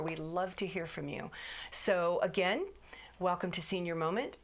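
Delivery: ordinary delivery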